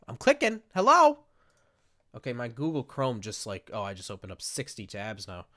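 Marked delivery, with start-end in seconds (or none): 0.1-1.2 high, loud exasperated hello; impatient calling out. Real, not acted. Fed up with a computer ignoring the clicks, impatient and raised. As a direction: call out impatiently at something not responding.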